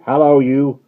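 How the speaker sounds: loud, high hail; guard hailing the rider. Acted. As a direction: call him out.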